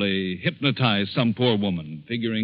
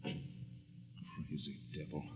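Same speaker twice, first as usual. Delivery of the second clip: muttered curse; 8 semitones lower, 24 dB quieter, much slower than usual. Acted. Muttered alone after villain leaves.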